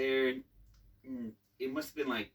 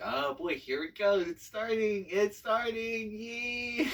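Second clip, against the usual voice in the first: mock dread; 10 semitones higher, 7 dB louder, slower than usual. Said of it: "And I was like".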